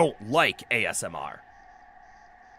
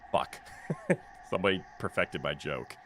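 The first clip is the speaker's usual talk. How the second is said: usual speaking voice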